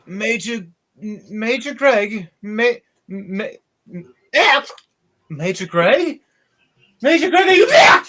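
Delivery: stammering, rising into a high frantic call; calling out for the major, growing desperate; panicked soldier calling. Acted. Soldier calling his lost major, rising panic. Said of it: "this guy looks over and goes".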